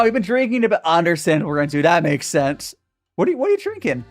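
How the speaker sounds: ordinary delivery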